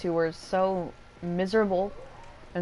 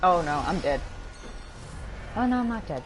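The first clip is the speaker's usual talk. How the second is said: ordinary talk, nothing marked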